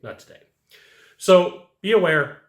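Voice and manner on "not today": Not today, said much softer; soft final Not today; quiet echo. Shown as a demonstration. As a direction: drop to almost nothing on Not today. Repeats refusal softly.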